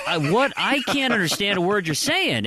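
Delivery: high-pitched mock bewilderment; acted exasperation. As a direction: protest as if baffled, pitch jumping up. Acted exasperated reply to Minnesotans.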